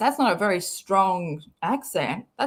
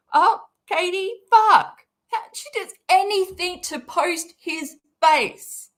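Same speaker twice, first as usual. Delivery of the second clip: high, raised-voice exasperation; exasperated outburst; 8 semitones higher, 4 dB louder than usual. Real, not acted. Scolding Katie for posting his face, high exasperation. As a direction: let it burst out high, fed up.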